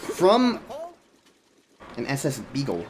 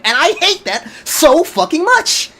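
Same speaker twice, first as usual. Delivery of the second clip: much higher, louder and slowed, fuming; angry rant; 12 semitones higher, 7 dB louder, slower than usual. Real, not acted. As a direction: spit it out in real frustration. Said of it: "sorry about all the rants".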